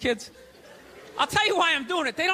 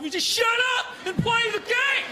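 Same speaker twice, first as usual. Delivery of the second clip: mock furious fan; 10 semitones higher than usual. Nashville fan yelling at Terrell, furious. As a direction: yell at the television.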